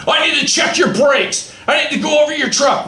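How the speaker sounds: mock the scale master's demands; officious inspector. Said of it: "they talk that way to us".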